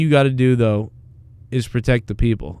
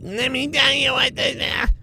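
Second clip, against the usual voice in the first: mocking voice; 12 semitones higher, 5 dB quieter, slower than usual. Mocking a type of job applicant.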